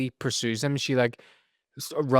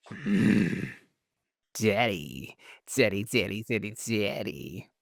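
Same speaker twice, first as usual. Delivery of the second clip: mock swooning; 2 semitones lower, 3 dB quieter, slower than usual. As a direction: moan the words like a smitten fan. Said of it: "And I was just like".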